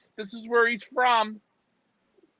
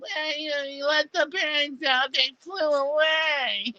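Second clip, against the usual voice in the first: mock whining; 2 semitones higher than usual. Mocking the complaints about birds let out, whining. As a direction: whine it out like a sore loser. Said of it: "I don't wanna hear the whining".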